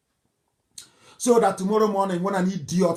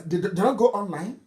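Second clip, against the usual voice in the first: ordinary talk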